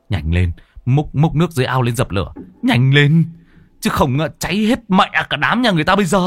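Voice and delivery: fast, rising, frantic shout; high, rushed command building in force; urgent orders. A narrator's reading. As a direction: hurry everyone along before it all burns. Urgent orders to fetch water for fire.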